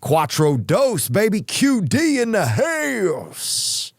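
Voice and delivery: high, slow, stretched hype call; mock hype-man bragging; hype shout-out. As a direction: drag it out like an announcer hyping a crew. Hyping up his fire station gift.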